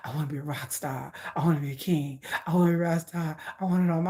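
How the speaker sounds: deep, quiet mock rapper voice; playful low imitation. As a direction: drop it low like him. Imitating [name]'s deep rap voice.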